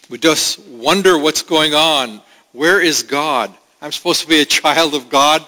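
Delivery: ordinary talk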